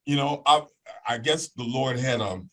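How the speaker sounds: ordinary talk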